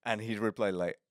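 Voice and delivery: ordinary delivery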